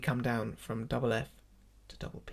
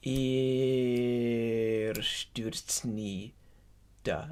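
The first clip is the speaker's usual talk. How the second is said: very slow "Er", held long, then "nie", break, "da"; separated syllables; 2 semitones lower, 3 dB quieter, much slower than usual. Shown as a demonstration. Teacher models the phrase for choir, "nie" and "da" separated. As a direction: draw out "Er stürzt", split "nie" from "da". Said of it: "we separate nie".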